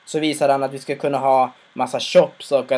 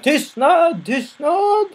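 shouted silence; 14 semitones higher, 4 dB louder, much slower than usual. Retold or imitated. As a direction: cut the crowd off with a shrill demand. Boss silences the crowd.

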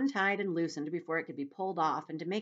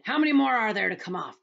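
loud, higher demanding centipede; crying out; 5 semitones higher, 9 dB louder than usual. A narrator's reading. Centipede asking James about his boots, loud cry. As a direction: demand it loudly. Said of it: "the centipede cried".

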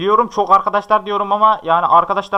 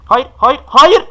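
loud, climbing no; real anguished gamer yell; despairing shout; 7 semitones higher, 8 dB louder, much slower than usual. Real, not acted. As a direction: yell no as he dies. Dying near the bed.

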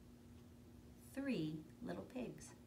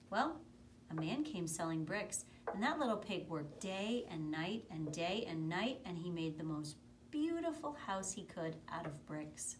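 plain reading voice

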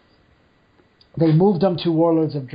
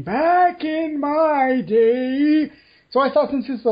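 put-on high, very slow creaky old-timer voice; old person voice; 10 semitones higher, 4 dB louder, slower than usual. Shown as a demonstration. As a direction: drag it out like a frail old man. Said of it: "in the old person voice".

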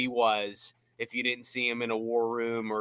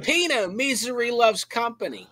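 a higher, self-admittedly rough take on the GM; Kevin Colbert impression; 10 semitones higher than usual. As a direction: toss off a quick, lightly voiced Colbert line. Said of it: "not even a really good Kevin Colbert impression".

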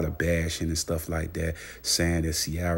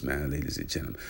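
ordinary delivery

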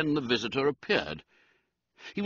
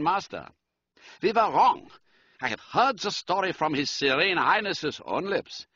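higher-pitched German count telling a tale; formal, emphatic German-accented storytelling; 7 semitones higher than usual. A narrator's reading. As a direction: recount it dramatically in a German accent. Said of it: "He spoke with a German accent".

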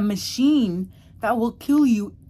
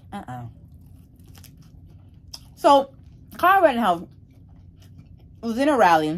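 muffled by food; chewing while talking; 5 dB louder than usual. Real, not acted. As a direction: mouth partly full of food. Seen 0: Bites rib just before; lips pursed, chewing motion while speaking.

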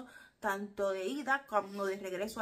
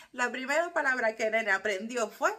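louder, rising toward the punchline; excited build-up; 2 semitones higher, 9 dB louder than usual. Real, not acted. Telling her viewers the first word, rising excitedly. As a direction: wind the listener up for the reveal. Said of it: "building to the punchline".

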